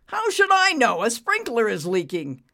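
loud, high burst, then dropping; exasperated snapping. A narrator's reading. Boss to maintenance man, exasperated snapping. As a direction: snap back impatiently.

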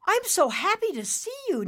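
high, loud, elated cry; happy shout. Acted. Nimbus reuniting with his dad, happy shout. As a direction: shout it with delight. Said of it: "Nimbus shouted".

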